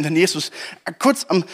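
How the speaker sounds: ordinary delivery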